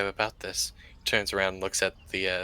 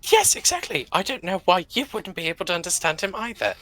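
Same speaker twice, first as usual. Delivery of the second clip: high, loud in-character voice; high, incredulous character reply; emphatic agreement; 9 semitones higher, 6 dB louder than usual. Acted.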